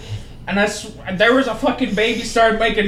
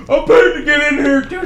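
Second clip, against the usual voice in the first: louder, higher imitation of indignation; mock defiant retort; 7 semitones higher, 7 dB louder than usual. Drunk woman answers a complaining moviegoer, mock defiant. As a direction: fire back like an offended moviegoer.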